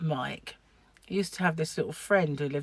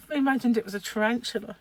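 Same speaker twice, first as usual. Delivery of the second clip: usual speaking voice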